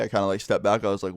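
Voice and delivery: ordinary talk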